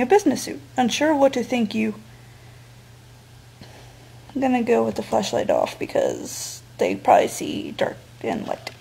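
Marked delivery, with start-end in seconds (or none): 4.3-8.7 breathy whispered aside to viewers. Real, not acted. Player whispering his game choice to the audience. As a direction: hush it like a secret plan.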